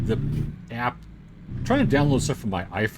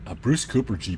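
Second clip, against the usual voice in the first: Ace Ventura voice; 4 semitones higher than usual. Retold or imitated. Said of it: "Ace Ventura".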